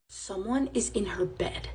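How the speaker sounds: soft, slow, dropped to near a whisper; the scary beat told under the breath; hushed reveal. A narrator's reading. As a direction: let the reveal sink in quietly. Narrated reveal, intruder in bed.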